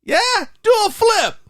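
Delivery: very high, loud dare; imitated heckling shout. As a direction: yell it up at a superhero. Acting out bystander's dare to hero.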